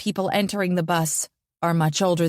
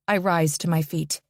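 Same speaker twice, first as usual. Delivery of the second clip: ordinary delivery, nothing marked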